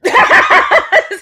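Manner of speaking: high squealing burst of laughter; shrieking laugh. Real, not acted. Laughing at the coffee joke again, a shrieking laugh.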